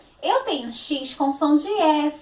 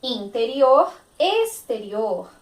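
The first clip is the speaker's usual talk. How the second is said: ordinary delivery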